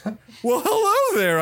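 high, swooping hello; showman cat host. Acted. Cat host greets the reluctant newcomer, high and showy.

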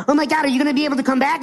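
acted alarm, racing words; freaking out. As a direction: rush it out like a panicked friend. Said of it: "he's, like, freaking out".

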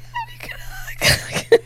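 very high, slow, near-crying squeak; barely holding back happy tears; very high, slow tearful yes; overwhelmed joy. Retold or imitated. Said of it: "And I was like".

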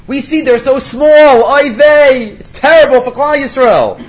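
hammed-up Yiddish lament; mock kvetching lament.

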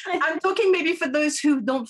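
high-pitched, laughing go-ahead; laughing encouragement. Real, not acted. Host answers guest's playful boast, laughing and high. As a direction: egg them on through laughter, voice jumping up.

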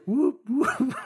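high whoops of a nearing siren; imitated police siren. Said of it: "it's getting nearer and nearer".

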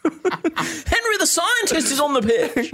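high, slow plummy voice through laughter; posh voice, laughing. Pretend. Joining the joke about the posh team, high plummy laughing voice. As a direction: announce it like a giggling toff.

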